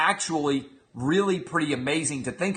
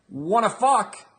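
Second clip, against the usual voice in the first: higher, hopeful ask; hopeful invitation; 7 semitones higher, slower than usual. Shown as a demonstration. Husband hopefully asking his wife, playful invite. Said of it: "that's a hopeful moment".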